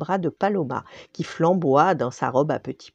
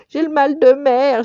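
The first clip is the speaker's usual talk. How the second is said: higher, louder seasick wail; moaning captain; 10 semitones higher, 8 dB louder than usual. Acted. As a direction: whine it, sick to the stomach. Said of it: "gémit le capitaine".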